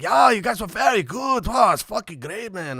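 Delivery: high mock German praise; German fan. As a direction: lightly play a fan gushing in accent. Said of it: "he's like".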